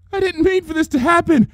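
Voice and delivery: very high, breaking desperate plea; desperate pleading. A narrator's reading. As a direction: beg through the panic. Trixie begs.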